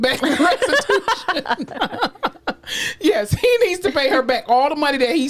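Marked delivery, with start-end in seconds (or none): 0.0-1.7 laughs through the word; slow, laughing correction. Real, not acted. Laughing at own word choice. 1.7-2.9 high-pitched giggle; soft high laugh. Real, not acted. Laughs after the joke.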